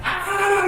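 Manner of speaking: high startled laughing cry; host howling on stage; laughing shriek. Retold or imitated. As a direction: yelp with laughter. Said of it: "Devin's dying laughing".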